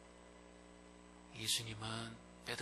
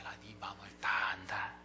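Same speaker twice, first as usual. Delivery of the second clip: hushed, tender words of Jesus; tender whisper; 3 semitones lower than usual. Acted. Jesus whispering tenderly to ashamed Peter. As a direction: whisper it as a gentle reassurance.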